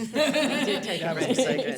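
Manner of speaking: slow, laughing tease. Real, not acted. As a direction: giggle through the words. Teasing about the breath.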